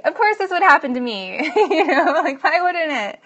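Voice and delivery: loud, high-pitched sarcastic acceptance with a laugh; mock resignation. Woman joking about her bad luck, in loud mock resignation. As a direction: laugh off your own terrible luck.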